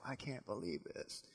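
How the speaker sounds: very soft inner voice of amazement; hushed disbelief. Acted. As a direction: Esther marvelling under her breath. Esther's private thought.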